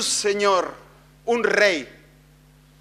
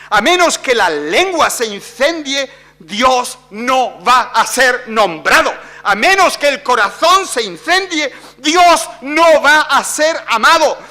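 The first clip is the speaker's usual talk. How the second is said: builds from firm to shouted, higher and louder; rising preaching climax; 7 semitones higher, 6 dB louder than usual. Real, not acted. Preacher crying out to the church, building to a shout. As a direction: preach each line harder until you're shouting.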